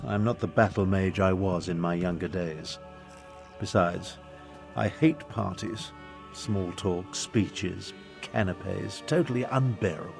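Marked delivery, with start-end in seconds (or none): 3.6-9.0 low, subdued grumble of distaste; weary distaste. Acted. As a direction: list them off softly and low, with disdain. Grumbling about hating parties.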